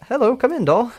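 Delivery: high, loud British greeting; British accent. Acted. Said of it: "I think she has a British accent".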